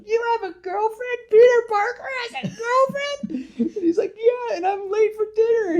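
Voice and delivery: put-on Harry thrilled his friend has a girlfriend; giddy Harry. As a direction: squeal it like an overexcited best friend. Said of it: "Harry's like".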